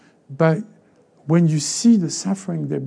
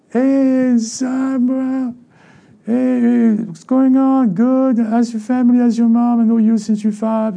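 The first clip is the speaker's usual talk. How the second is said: gushing small talk in a raised voice; mock hearty greeting; 5 semitones higher than usual. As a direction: play an over-familiar neighbor from back home. Acting out hometown folks greeting him.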